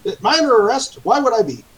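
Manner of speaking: very high-pitched, agitated protest; bewildered high-voiced captive pleading his case; confused prisoner. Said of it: "He still looks pretty confused".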